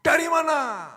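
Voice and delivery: a high-pitched wife's question. Retold or imitated. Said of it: "Nadanya tinggi". (istri bertanya "Dari mana?" dengan nada tinggi)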